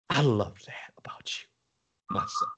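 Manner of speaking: soft whisper; whispered tenderness. Retold or imitated. God to his son, whispered. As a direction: whisper it like a father.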